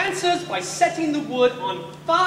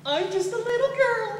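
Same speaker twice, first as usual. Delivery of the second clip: put-on much higher, light girly voice; mock little girl; 11 semitones higher than usual. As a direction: play Baal as a little girl. Elijah mocks Baal as girl.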